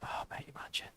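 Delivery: barely voiced whisper; hushed aside. Real, not acted. Streamer imagining Guru training with Tom, hushed whisper. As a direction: whisper it under your breath.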